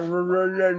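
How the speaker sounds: long run of slurred, rolling gibberish; garbled drone mocking bad diction; mock mush-mouth. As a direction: imitate unintelligible mumbling, all syllables run together. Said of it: "I don't need you to sound like".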